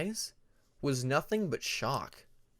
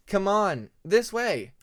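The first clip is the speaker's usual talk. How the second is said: bright, high, louder call to a friend; eager young dragon; 7 semitones higher, 8 dB louder, slower than usual. Acted. Peacemaker eagerly calling his oldest friend to follow him. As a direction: lead the way with eager excitement.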